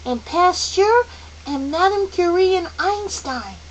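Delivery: put-on high, loud, eager child; calling out. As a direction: call out names in excitement. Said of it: "Charles Wallace called out".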